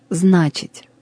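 ordinary delivery

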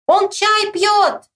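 high, slow holler; high drawn-out yell; shouting back. A narrator's reading. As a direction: stretch it out across the yard. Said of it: "кричит Мишка".

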